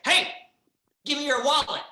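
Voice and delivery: sudden forceful stick-up yell; mugger's demand. Acted. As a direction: jump out yelling like a robber. Acted mugger demanding wallet.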